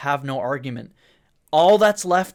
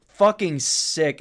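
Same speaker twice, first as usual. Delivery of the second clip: ordinary talk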